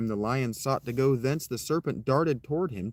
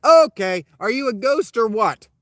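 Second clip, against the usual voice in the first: louder and higher, fed up and blunt; impatient call-out; 9 semitones higher, 10 dB louder than usual. Retold or imitated. Owen calling out to the sighing voice, impatient and loud. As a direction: yell into the dark, done with the sighing.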